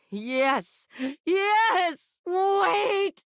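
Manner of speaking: high ecstatic yes then sudden wait; ecstatic cry. Acted. Character at climax calling out, high excited cries.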